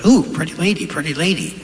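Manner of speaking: lightly voiced parrot cooing at the lady. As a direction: do the parrot, perched and flirty. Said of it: "Parrot goes".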